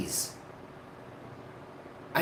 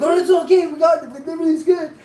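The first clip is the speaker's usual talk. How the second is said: Buddy reassuring; 13 semitones higher, 8 dB louder, faster than usual. Retold or imitated. Buddy reassures the panicking speaker.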